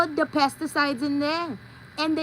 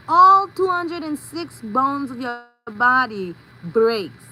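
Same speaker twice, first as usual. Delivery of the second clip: slow dramatic emphasis; 6 dB louder, slower than usual. Acted.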